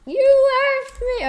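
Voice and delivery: high, loud wail of betrayal; loud outcry. Real, not acted. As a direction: call out as if abandoned. Left behind in game.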